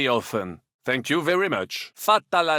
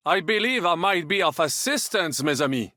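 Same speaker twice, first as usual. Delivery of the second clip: ordinary delivery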